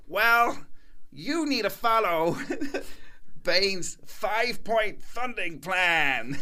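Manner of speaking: high, slow, utterly sure consultant pitch, laughing through it; confident consultant. Retold or imitated. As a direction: lay out the plan like gospel. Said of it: "with the most conviction I've ever seen".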